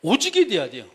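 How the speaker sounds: emphatic sermon delivery; preaching. Acted. Pastor preaching to the congregation, emphatic.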